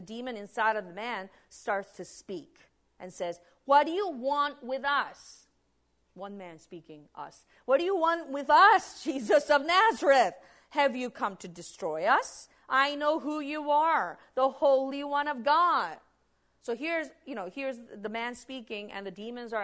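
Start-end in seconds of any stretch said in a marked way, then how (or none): 3.7-5.5 lightly voiced demon, slow and louder; demon speaking. Demon in a man confronts Jesus in the synagogue, demanding. 7.7-16.0 loud, high demonic challenge; demon's outcry. Acted. Demon inside a man calls out Jesus, loud and confrontational.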